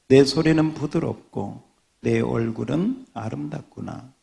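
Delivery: measured, solemn reading of scripture; steady, reverent Bible reading. Acted. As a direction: read it like a pulpit lesson. Pastor reads Song of Songs.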